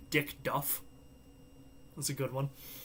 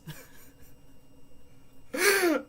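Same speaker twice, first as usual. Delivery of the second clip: high-pitched burst of real laughter; cracking up for real. Real, not acted. Streamer breaks into high real laughter at a chat joke.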